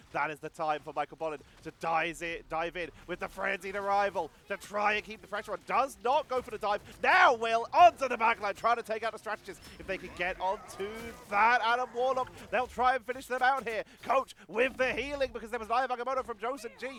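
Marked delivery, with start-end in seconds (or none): 5.6-16.9 louder, higher caster in full flow; live esports play-by-play. Real, not acted. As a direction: drive the action with mounting excitement.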